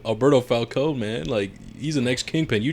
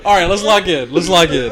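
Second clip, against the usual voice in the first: higher and louder through laughter; laughing; 6 semitones higher, 6 dB louder than usual. Real, not acted. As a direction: try to say it while cracking up. Laughing while urging focus.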